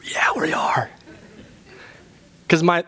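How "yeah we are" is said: strained, voiceless comeback; insistent inner voice. Acted. Head arguing back at the body, insistent. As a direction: argue back in a hushed rasp. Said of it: "the voice in my head says".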